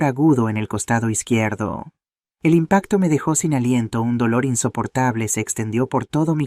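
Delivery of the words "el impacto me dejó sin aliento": ordinary delivery, nothing marked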